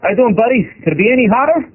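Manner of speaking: American patrolman making small talk; American cop accent. Acted. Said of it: "forgive my accent here".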